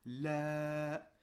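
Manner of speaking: "la" with a long, held vowel; prolonged vowel. Shown as a demonstration. Teacher reading the letter lem with alif, prolonged vowel. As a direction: hold the vowel of "la" steadily. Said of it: "de prolongation".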